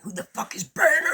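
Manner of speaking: sharp high angry shout; aggressive yell. Retold or imitated. Shouting at the cops through the window, aggressive yell. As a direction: bark it out to scare whoever is knocking. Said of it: "I aggressively went".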